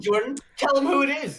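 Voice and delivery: high, loud, eager demand; excited urging. Real, not acted. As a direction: push him to spill the name, keyed up.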